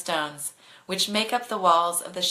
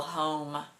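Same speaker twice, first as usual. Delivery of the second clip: mumble; 3 semitones lower, 6 dB quieter than usual. Acted. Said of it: "I mumble".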